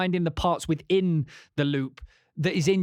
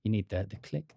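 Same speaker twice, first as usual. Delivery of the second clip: ordinary talk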